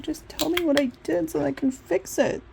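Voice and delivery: high, begging tone; begging the employer for feedback; pleading whine. Retold or imitated. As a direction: plead to be told.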